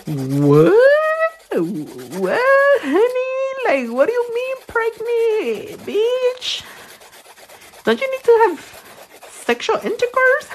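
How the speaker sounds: very high, incredulous squeal; hammed-up high shocked squeal; shocked disbelief. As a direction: shriek it in mock disbelief. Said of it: "I was like".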